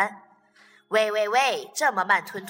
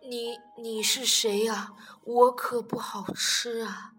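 faint scared stammer; 4 semitones lower, 5 dB quieter, slower than usual. Acted. Weak T-rex waking, scared.

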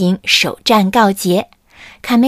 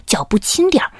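whispered caution; 5 semitones higher than usual. Acted. Camelido whispers to tiptoe past the miller. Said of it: "制定着计划".

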